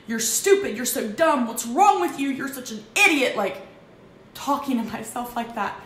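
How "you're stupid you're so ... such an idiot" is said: louder and higher, harsh self-berating; yelling at herself. Retold or imitated. She berates herself while rushing around, loud and harsh. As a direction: tear into yourself. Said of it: "like, yelling at myself".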